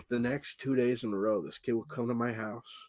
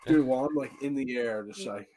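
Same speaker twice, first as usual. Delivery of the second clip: normal talking voice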